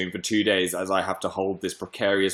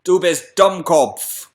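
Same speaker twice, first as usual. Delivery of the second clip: slow mock German teacher; lightly hammed German scolding; 6 semitones higher, 5 dB louder, much slower than usual. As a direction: spell out Du bist Dummkopf in German. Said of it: "our German teacher said to us".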